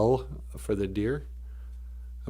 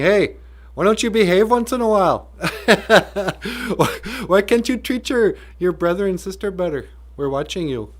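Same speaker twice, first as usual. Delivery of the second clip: high, loud grandparent's telling-off, playful; mock scolding; 8 semitones higher, 5 dB louder than usual. Grandparents in the stars to descendants, playful scolding. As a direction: nag like a grandparent, with laughter breaking in.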